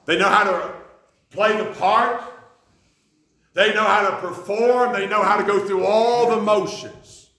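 loud, slow hammered preaching; preaching climax. Acted. Preacher condemning empty worship to the congregation, loud and slow. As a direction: build it up and hammer each line.

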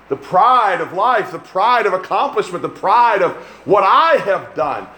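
high, slow, emphatic sermon cadence; impassioned preaching. Real, not acted. Preacher denouncing pride to the congregation, impassioned. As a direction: lean hard on each repeated phrase. Preacher drives his point home.

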